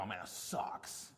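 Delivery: whispered, slow, half to himself; muttered reaction. Retold or imitated. Patient reading the two-hour wait notice, slow whispered groan. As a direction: breathe it out under your breath, disappointed. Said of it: "my first reaction".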